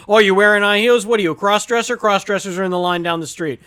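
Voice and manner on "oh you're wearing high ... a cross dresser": loud, higher-pitched mock heckle; rudely rip into a customer for laughs; sarcastic heckle. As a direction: imitate a sarcastic server calling someone out. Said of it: "sarcastic and rude to you on purpose".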